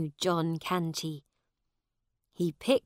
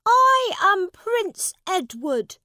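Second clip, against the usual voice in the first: put-on proud young prince; 11 dB louder than usual. Boy claims to be the prince.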